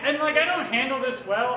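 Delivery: ordinary talk, nothing marked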